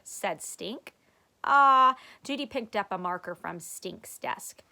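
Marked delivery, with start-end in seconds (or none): 1.4-1.9 loud ah. A narrator's reading. Judy gasp.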